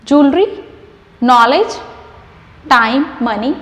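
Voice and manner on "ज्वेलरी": usual speaking voice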